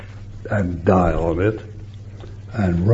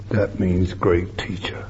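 hushed reverent whisper; 3 semitones lower, 7 dB quieter than usual. Real, not acted. Preacher whispering the meaning to the congregation. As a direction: breathe it softly.

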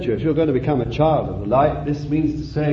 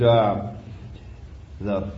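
normal talking voice

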